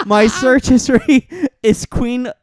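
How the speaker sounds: laughing through the words; cracking up mid-sentence. Real, not acted. Cracking up over search history.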